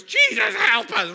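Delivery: loud, high desperate cry to Jesus; panicked prayer. Retold or imitated. Preacher mimicking frightened flyers praying, loud high desperate plea. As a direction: cry out like a sudden prayer warrior. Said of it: "prayerful warriors".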